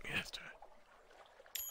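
ordinary talk

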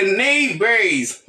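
neighbors slow and clear, NAY-bers; drawn-out neighbors; correct pronunciation. Shown as a demonstration. Teacher drilling the class on neighbors. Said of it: "se neighbors".

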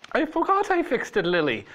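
high, fast feeble excuse; feebly. A narrator's reading. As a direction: blurt the excuse weakly. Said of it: "he said feebly".

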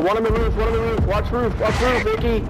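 urgent high-pitched callout; combat callout. Real, not acted. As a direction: call out the roof, fast and high. Urgent callouts of enemy on roof.

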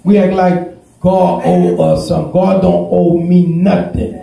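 slow, rising preacher's build to a shout; preaching at full force. Acted.

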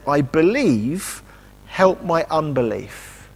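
ordinary talk, nothing marked

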